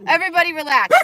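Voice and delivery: shouting. Real, not acted. Calming excited dogs, yelling.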